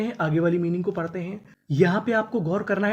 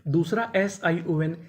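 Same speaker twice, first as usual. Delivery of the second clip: ordinary delivery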